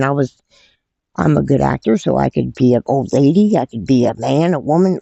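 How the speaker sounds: ordinary talk, nothing marked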